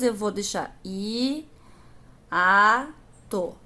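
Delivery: hiato said slowly as i, a, to, each syllable apart; teacher spelling out hiato syllable by syllable; split into syllables. Shown as a demonstration. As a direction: break hiato into three clear syllables i, a, to. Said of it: "na hora de separar as sílabas".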